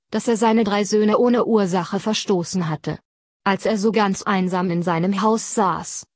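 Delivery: plain reading voice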